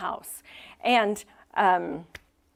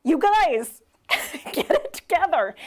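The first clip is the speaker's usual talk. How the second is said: much higher and louder, laughing exasperation; exasperated scolding; 9 semitones higher, 7 dB louder than usual. Retold or imitated. Pastor reacting to the family mess, with laughing exasperation. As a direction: throw up your hands at the mess, laughing. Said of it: "this story is like".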